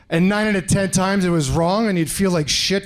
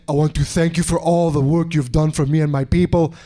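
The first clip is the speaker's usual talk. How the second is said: lower, earnest mock African accent; put-on African accent; 4 semitones lower than usual. African stranger thanks his supposed benefactor, put-on African accent. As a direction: thank him solemnly in a vague African accent. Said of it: "what part of Africa that accent from".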